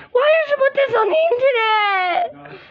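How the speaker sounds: high sobbing shout; hammed-up crying outburst; pretend crying shout. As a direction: wail the question through tears. Doll betrayed, crying shout.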